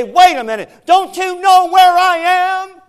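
high, loud, slow shout; a loud, stretched-out cry of protest. Acted. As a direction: yell it out like a wronged man.

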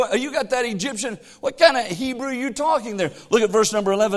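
ordinary talk

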